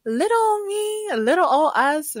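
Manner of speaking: high, slow coy voice; mock modest. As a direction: play it falsely humble and cute. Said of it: "'cause I'm like".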